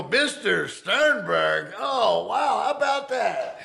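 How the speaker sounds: high, slow sucking-up greeting, hammed-up; mock fawning guard. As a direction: fake delight to a released prisoner. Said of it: "Everybody's kissing my ass".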